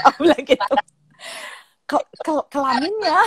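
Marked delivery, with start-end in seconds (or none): 1.8-3.2 retold stutter of disbelief, pitched up; shocked stammer. Mother repeats daughter's shocking word back, stammering imitation. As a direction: trip over the word in mock shock. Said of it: "sampai berebet gitu".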